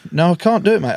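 ordinary talk, nothing marked

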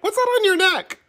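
shrill-free high mock boss quiz. Boss asking about the hickey in a high mock voice.

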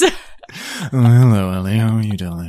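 a hint of a suave British Ken; co-host doing a light Ken impression for the host. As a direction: greet her like a lightly voiced smooth Ken. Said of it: "that's my Ken impression".

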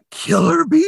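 hammed-up horrified gasp; mock horror gasp. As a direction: gasp and blurt it like a B-movie scare. Mock horror at bee theory.

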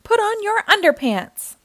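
high, loud grumpy mom order; a mad mom snapping an order. A narrator's reading. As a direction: bark it like a cross mother. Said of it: "mom was always mad".

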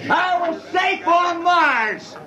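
a crewman toasts the first Earthmen on Mars, laughing and shouting; drunken laughing shout. Acted. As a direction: laugh it out like you're already drunk. Drunken crewman celebrating landing.

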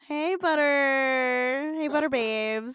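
slow, drawn-out sugary greeting; cutesy drawl. Pretend. Mocking the couple's pet name, slow and sugary. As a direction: stretch it out lovey-dovey.

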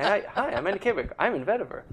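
mock his eager intro; imitating Andy Kabik. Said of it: "Every time".

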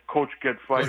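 put-on light Russian accent, words clipped; imitating a Russian teammate's accent. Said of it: "Zelepukin in his Russian accent".

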